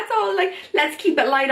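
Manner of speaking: laughing lightly, pitch up; jokey laugh. Retold or imitated. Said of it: "they jokingly laugh".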